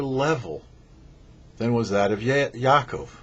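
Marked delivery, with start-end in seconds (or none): none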